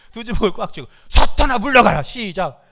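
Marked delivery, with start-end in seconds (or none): 1.1-2.0 loud, forceful demonstration shout; clenched-fist rebuke. Shown as a demonstration. (주먹을 꽉 쥔 듯 힘찬 외침)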